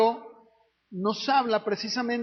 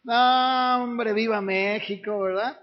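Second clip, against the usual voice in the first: normal talking voice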